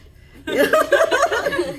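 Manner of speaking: bright, high laugh; high burst of laughter. Real, not acted. Laughs at her own joke.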